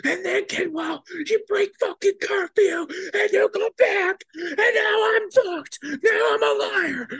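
very high, loud wailing scream; hammed-up tearful screaming tirade; sergeant's mock sobbing meltdown at him; mock crying rant. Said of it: "losing his shit on me".

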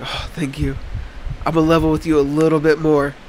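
normal talking voice

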